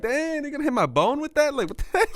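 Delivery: hammed-up fear of the needle; mock alarm. Imagining the big needle, hammed-up alarmed and laughing.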